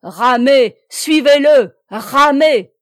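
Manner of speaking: very loud, high commands; shouted orders. A narrator's reading. Plélan ordering his rowers to follow, shouted orders. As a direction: bellow each order to the crew. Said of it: "cria Plélan".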